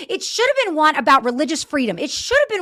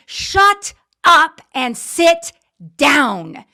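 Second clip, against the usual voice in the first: slow, loud, spelled-out order; angry; 2 semitones higher, 6 dB louder, much slower than usual. Real, not acted. Host ordering Democrats to stop calling for resignations, angry. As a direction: drag each word out like a furious command. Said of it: "Because I'm angry".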